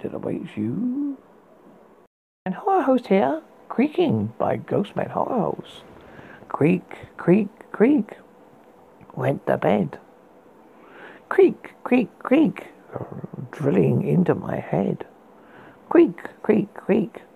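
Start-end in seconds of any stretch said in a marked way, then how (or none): 2.4-5.8 horror host voice. Acted. Host persona intro. 6.5-8.2 whispered creaking. Acted. Creaking bed sound imitated. 11.3-12.7 high creak. Acted. Creaking bed sound imitated. 15.9-17.2 high breathy creak sounds; whispered creaking. Acted. Creaking bed sound imitated.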